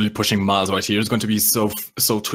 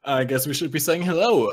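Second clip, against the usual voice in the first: ordinary talk, nothing marked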